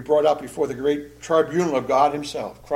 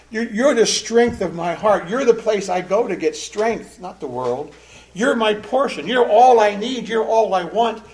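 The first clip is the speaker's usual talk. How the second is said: fervent devotion; 6 semitones higher, 4 dB louder than usual. Acted. Preacher voicing Asaph's devotion.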